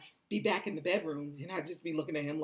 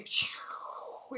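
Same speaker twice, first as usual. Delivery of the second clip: warp sound. Retold or imitated. Warp speed sound effect.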